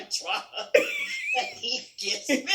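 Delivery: laughing through the words. Real, not acted. Host teasing about her husband's drama, laughing. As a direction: giggle out the teasing punchline.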